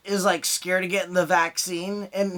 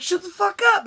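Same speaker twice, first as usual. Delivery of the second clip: high, loud annoyed snap; 13 semitones higher, 3 dB louder than usual. Retold or imitated. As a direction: snap at the crying baby. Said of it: "And you're like".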